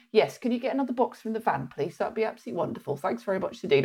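mock posh polite request; quiet posh politeness. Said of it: "they go".

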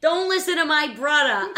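put-on loud, high, laughing Boston accent; hammed-up Boston radio brother. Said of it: "car talk".

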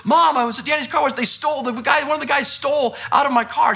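high-pitched, stumbling mock report of a theft; imitated agitation, pitched high; agitated retelling. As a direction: play an upset daughter blurting it out to mom. Daughter reporting a theft to mom.

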